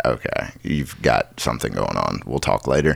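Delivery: low, soft, tired brush-off; weary low mumble. Retold or imitated.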